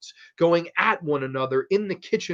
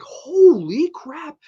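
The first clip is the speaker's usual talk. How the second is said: slow, drawn-out, high and loud holy crap; stunned reaction; 13 semitones higher, 8 dB louder, much slower than usual. Retold or imitated. Viewer watching the gory scene, slow stunned exclaim. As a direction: let it sink in with shocked disbelief. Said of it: "I'm just like".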